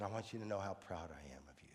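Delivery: low, soft, heartfelt praise; old veteran praising another vet, low and tender; tender and sincere. Retold or imitated. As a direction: tell her gently how proud you are.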